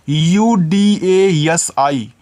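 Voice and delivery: slow, letter by letter; spelling letters out. Shown as a demonstration. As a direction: spell each letter slowly for the children. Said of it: "teacher spells letters for children".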